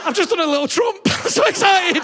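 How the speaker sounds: high, loud gleeful squeal; overexcited puppy. Acted. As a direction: squeal like a puppy bursting with excitement. Acted excited puppy.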